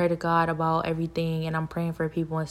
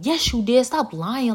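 higher-pitched jeering accusation; mock accusing; 6 semitones higher, slower than usual. Liars in the background accusing her, mocking. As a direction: imitate someone egging on the blame.

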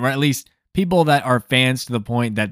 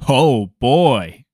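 a mock, louder "oh boy"; imitating a deeper voice; 5 dB louder than usual. Woman reacting to John Wick's shot, imitated deeper. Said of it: "deepening my voice when I'm imitating her".

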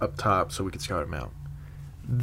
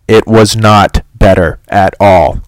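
emphatic; 15 dB louder, slower than usual. Real, not acted. Disappointed by Game of Thrones.

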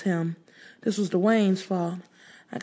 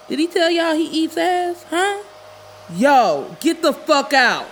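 Dwayne yelling; 10 semitones higher, 7 dB louder, slower than usual. Acted.

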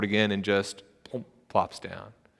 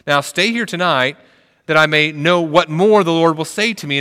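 raised, higher proclaiming voice; a lightly voiced Balaam, raised and emphatic; Balaam's reply; 6 semitones higher, 7 dB louder than usual. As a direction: voice Balaam boldly, pitched up and louder.

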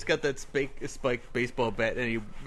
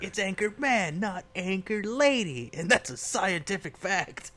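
Ron Burgundy quote; 8 semitones higher, slower than usual. Retold or imitated. Quoting Ron's rant.

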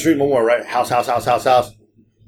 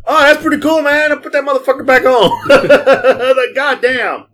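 much higher, laughing mock awe; amused mock reaction; 11 semitones higher, 4 dB louder than usual. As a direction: laugh through the fake admiration. Mock reaction to shoe story.

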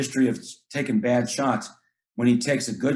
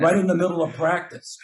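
higher, fired-up coach talk; emphatic praise; 6 semitones higher than usual. Acted. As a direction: drive it home to the player. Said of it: "I really try to praise that guy".

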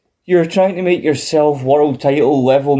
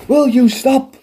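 imitating Gorilla; 11 semitones higher, slower than usual. Gorilla pleading with Heenan to stop, high and exasperated. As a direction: cry out at a partner who won't quit. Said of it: "You know".

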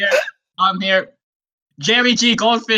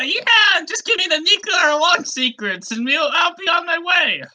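high-pitched cartoon frog voice; Frankie Frogfish; 7 semitones higher than usual. Fish back from the dead greets the gang, high put-on voice. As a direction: pitch it up for a returning fish character. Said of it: "That's his classic Frankie voice".

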